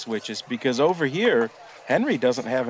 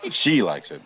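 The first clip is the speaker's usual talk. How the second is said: laughing. Real, not acted. Echoing the host, laughing.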